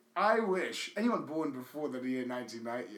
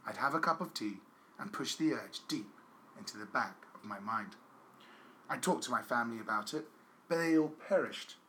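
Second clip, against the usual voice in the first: low, measured reading aloud; letter reading; 5 semitones lower, 5 dB quieter than usual. Acted. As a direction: read a solemn confessional letter, deadpan. Reads out a letter.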